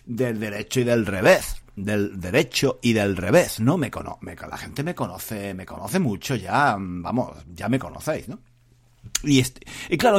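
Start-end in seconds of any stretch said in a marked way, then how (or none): none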